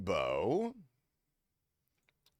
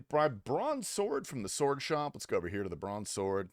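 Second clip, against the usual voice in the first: ordinary talk, nothing marked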